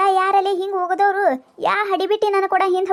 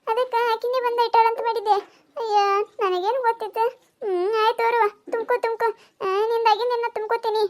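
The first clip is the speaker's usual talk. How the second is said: higher, surprised and apologetic; flustered woman; 3 semitones higher than usual. Acted. Sharadakka is told the old woman just came and backs down, higher and flustered. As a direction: back down, surprised and a little sheepish, pitched up.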